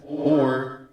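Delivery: calm, stately sorceress. Acted. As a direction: speak as a grave sorceress. GM voices Helena.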